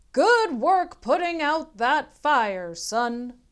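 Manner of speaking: lightly voiced proud fire chief, slow; hearty fire chief, drawn out; booming chief praising a young hero.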